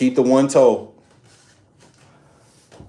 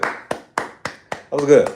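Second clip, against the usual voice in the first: drawn-out praise; 4 semitones higher, much slower than usual. Shown as a demonstration. Repeated slow praise.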